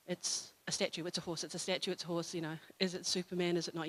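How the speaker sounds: soft, low running thought; inner musing. Retold or imitated. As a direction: think it through under your voice. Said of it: "that's what I'm thinking".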